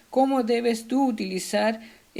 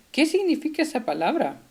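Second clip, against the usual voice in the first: "Qué significa" asked quickly on a raised pitch; brisk, high-pitched question on "Qué significa esa palabra"; question intonation; 6 semitones higher, faster than usual. Shown as a demonstration. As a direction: ask "esa palabra" as a real doubt, higher. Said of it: "utiliza signos de interrogación".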